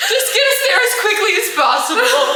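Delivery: high, loud wailed plea; mock tearful urging. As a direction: urge the driver on in a hammed-up wail. Tearful urgent order to driver.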